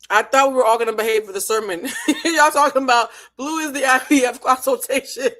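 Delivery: loud laughing through the words. Real, not acted. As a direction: laugh while talking. Laughing at the group's joke.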